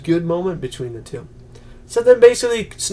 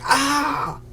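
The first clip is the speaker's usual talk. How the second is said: mock yelp; 11 semitones higher than usual. She yelps when injected.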